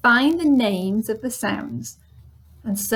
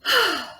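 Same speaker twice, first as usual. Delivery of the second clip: high falling sigh; 9 semitones higher than usual. Shown as a demonstration. Teacher demonstrates a sigh, falling sigh. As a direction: let out a long sigh. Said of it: "I can sigh".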